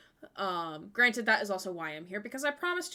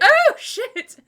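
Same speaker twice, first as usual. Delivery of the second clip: loud high burst of surprised laughter; real startled laugh-shout; laughing shock; 12 semitones higher, 12 dB louder than usual. Real, not acted. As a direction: shriek it through a laugh. Reacting to chat cheer effect.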